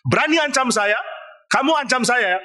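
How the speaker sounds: high, indignant outburst at being threatened; a furious king, pitched high and offended; raging Pharaoh. Acted. Pharaoh raging at Moses for threatening him, high indignant outburst. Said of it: "Firaun ngamuk".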